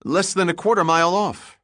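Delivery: high, loud, startled report; young helmsman, high and excited; excited stutter. A narrator's reading. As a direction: blurt it out in a rush of alarm. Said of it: "he stuttered excitedly".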